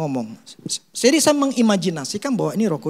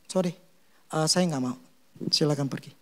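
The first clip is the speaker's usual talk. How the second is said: calm dismissal; 5 semitones lower, 8 dB quieter than usual. Retold or imitated. Womack dismisses the late man.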